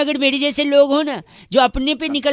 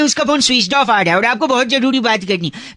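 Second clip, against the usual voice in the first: ordinary talk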